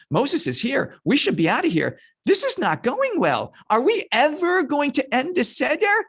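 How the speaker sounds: high, whiny grumbling; hammed-up exasperation; impatient kvetching. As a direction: complain with pitch climbing to a final wail. Israelites kvetching, impatient to leave.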